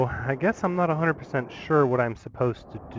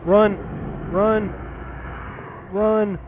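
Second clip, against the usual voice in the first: panicked shouting while fleeing; being chased; 9 semitones higher, slower than usual. Real, not acted. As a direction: yell it, running for your life. Fleeing monster in game.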